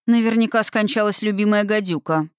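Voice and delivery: ordinary delivery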